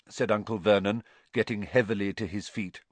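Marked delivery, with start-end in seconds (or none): none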